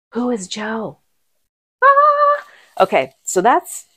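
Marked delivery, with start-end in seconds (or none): 1.8-2.4 high loud "Ah!" of astonishment; excited shriek. Real, not acted. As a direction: let out a thrilled yelp. Shock at second Joe coincidence.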